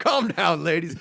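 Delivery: high loud teasing call; mock condescending. Joking to the ladies, a high mock scolding.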